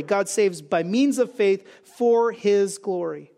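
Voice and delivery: slowed, deliberate, stress on "means"; slow emphatic thesis. Real, not acted. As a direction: lay down the thesis word by word. Preacher restates main thesis.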